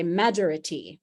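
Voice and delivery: majority stressed on MA instead of JOR; first-syllable stress. Retold or imitated. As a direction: imitate a learner saying MA-jority. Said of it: "with a stress on the first syllable".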